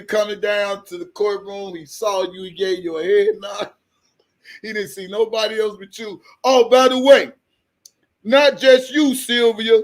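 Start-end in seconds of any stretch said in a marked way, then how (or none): none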